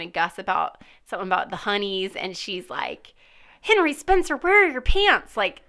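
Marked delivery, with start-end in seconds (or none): 3.6-5.4 higher, exasperated mock scold; stressed Chief Vick. Chief Vick scolding Henry about his pants, higher and exasperated. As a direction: demand it like a frazzled boss.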